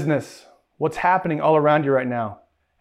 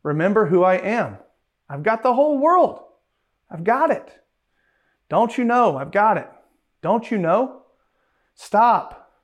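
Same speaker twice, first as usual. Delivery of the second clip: higher, urgent "Don't you know?"; pleading voice of God; 5 semitones higher than usual. Retold or imitated. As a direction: plead with them to remember. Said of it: "He's saying".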